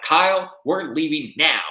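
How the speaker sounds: loud, high angry summons; mock pissed-off. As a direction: imitate a furious order to a companion. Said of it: "And then she got pissed".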